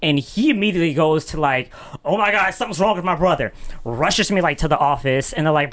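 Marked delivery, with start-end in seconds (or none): 2.0-3.5 fast, high mock alarm; panicked. Brother rushing the crying boy to the office, panicked. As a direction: imitate a frantic little brother.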